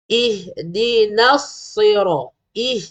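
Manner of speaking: slow recited "Ihdinas" opening on clear "ih"; correct vowel. Shown as a demonstration. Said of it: "Bukan ih". (baca "Ihdinas" perlahan, bunyi "i" jelas)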